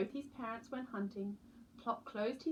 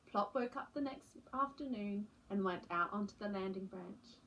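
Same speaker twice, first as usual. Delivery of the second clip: ordinary delivery, nothing marked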